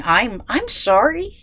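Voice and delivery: normal talking voice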